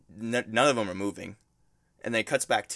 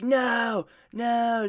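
high, drawn-out mock wail; freaking out; 12 semitones higher, 4 dB louder than usual. Friend retells a girl seeing her ex abducted, mock freak-out. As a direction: imitate a desperate cry of no, much higher. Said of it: "she starts crying, starts freaking out".

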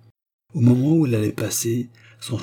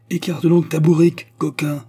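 higher and louder, a barked rebuke; angry growl; 7 semitones higher, 5 dB louder than usual. Acted. Squire scolding the peasant blocking the road, angry shout. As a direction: snap at the peasant to move the donkey. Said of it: "gronda-t-il d'une voix de basse".

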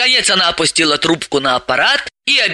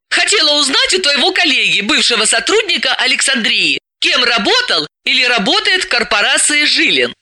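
put-on higher girlish voice, arguing back; Dalila, young and stubborn; 7 semitones higher than usual. Dalila answers her bossy friend, higher and argumentative. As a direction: push back with a lively counter-argument.

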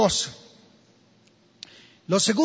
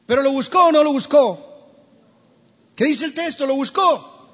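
preaching challenge; 5 semitones higher, 6 dB louder than usual. Acted.

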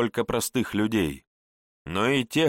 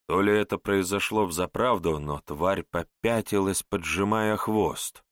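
usual speaking voice

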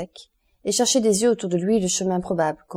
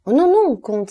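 much higher, louder cry of protest; 6 semitones higher, 8 dB louder than usual. A narrator's reading. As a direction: cry out to stop him. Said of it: "s'écria Morrel". (s'écrier, beaucoup plus aigu et plus fort)

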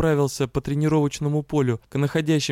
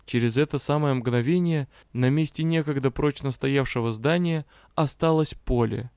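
ordinary delivery, nothing marked

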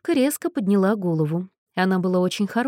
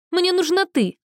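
high, loud tearful cry; protest; 11 semitones higher, 4 dB louder than usual. Acted. Girl cries out at Anna, high and loud. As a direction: shout it through tears.

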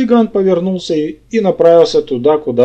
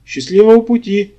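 higher-pitched, lightly gloating farewell; a touch of spiteful triumph; gloating rasp; 6 semitones higher than usual. A narrator's reading. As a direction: send him off with malicious glee. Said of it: "злорадно прохрипел ему вслед".

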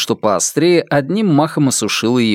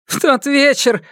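snorting; 13 semitones higher than usual. A narrator's reading. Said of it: "хмыкнула она".

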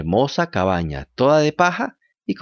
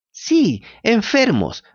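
high echoing cat voice; 10 semitones higher, much slower than usual. A narrator's reading. Cat echoes the fox.

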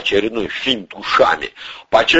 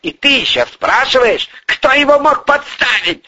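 high, angry bark; outraged, high-pitched snarl of a question; barked reproach; 3 dB louder than usual. A narrator's reading. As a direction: bark at him in disbelief. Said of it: "рявкнул Артём Павлович".

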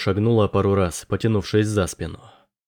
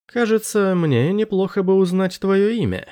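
much higher, tentative character voice; awkward Anders; 9 semitones higher than usual. Acted. Anders walking beside the sergeant asking her name, high and tentative. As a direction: pitch it high, feeling out a polite question.

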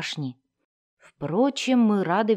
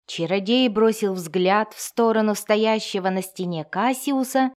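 usual speaking voice

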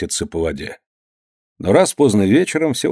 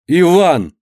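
крик; 7 semitones higher, 4 dB louder than usual. A narrator's reading. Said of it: "крикнула Анна".

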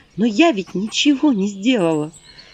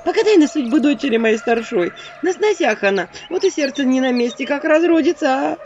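pleading; 7 semitones higher than usual. Acted. Woman begs the witch.

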